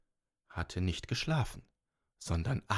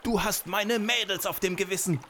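high, loud accusing giant; enraged giant voice; angry giant; 10 semitones higher, 5 dB louder than usual. Acted. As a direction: hurl the blame at her furiously. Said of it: "rief der Riese".

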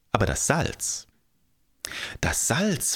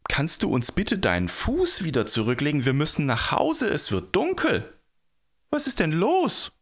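high-pitched pleading call; urgent appeal pitched up high; 8 semitones higher than usual. A narrator's reading. People ask the giant to return his foot, calling out. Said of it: "riefen die Leute".

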